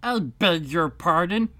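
politely offended mouse; frowning but polite. Acted. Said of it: "frowning, but very politely".

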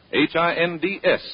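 ordinary delivery

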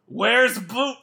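audibly asking. Retold or imitated. Impatient viewer asking the screen, audibly calling out. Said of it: "I was audibly just saying that".

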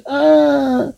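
high frightened scream. Acted. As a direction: scream as she is grabbed. Woman seized, screams.

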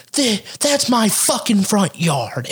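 high, slow mock outrage; irate neighbor lady. Lady defending her front yard, mock outraged. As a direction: lean hard on each word as the angry neighbor. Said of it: "she's like".

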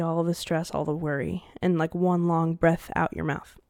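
soft, calm guided breathing voice; breathing guide. Acted. Podcaster guiding listeners through a breath. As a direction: lead the breath out gently.

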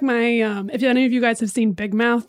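usual speaking voice

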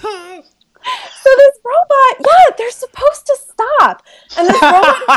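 high, laughing through the words; cracking up as she tells it. Real, not acted. Nurse breaking into laughter telling podcast host the story. Laughing while recounting robot crash.

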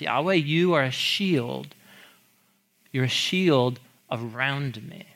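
slow, reverent reading of the psalm; scripture reading. Acted. Pastor reading the psalm to his listeners, slow and reverent. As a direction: read it like a prayer, unhurried. Quoting psalm verse slowly.

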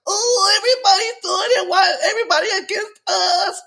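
very high, laughing mock sob with a wail; hammed-up crying whine, playing the victim; whiny crybaby fan. Mocking Michigan fans as crybabies, very high hammed-up wailing sob. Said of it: "they wanna be like".

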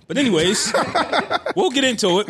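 laughing through the words. Real, not acted. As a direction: chuckle it out, pitch up. Laughing on lines.

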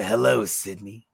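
low, slow creepy greeting; killer's phone voice. Acted. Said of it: "He went straight to".

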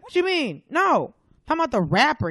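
high, loud disbelief; imitated indignant customer. Customer told the rapper is dead, imitated indignant confusion. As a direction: push back, puzzled and insistent. Baffled customer argues, retold.

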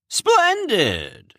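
high delighted exclamation; beaming. Acted. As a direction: burst out with joy. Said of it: "Cronus beamed".

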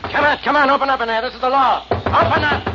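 loud, high demand at the door; shouting. Acted. Policeman at the locked farmhouse door, shouting. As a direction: bang on the door and bellow to open up.

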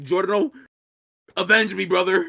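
high, loud dying last words; overacted death scene. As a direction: ham up a hero's final plea. Dead character speaks from clouds.

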